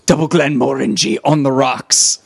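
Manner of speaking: lightly imitated gruff order of a character; quoted bar order. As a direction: order a drink as the film's hero. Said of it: "and he goes".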